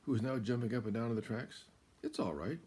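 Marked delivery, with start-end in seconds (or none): 2.0-2.6 high, loud, coaxing fox voice; sly little fox. Acted. Fox luring Cow to explore the countryside, high and coaxing. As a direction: tempt her like a playful little fox. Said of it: "said the fox".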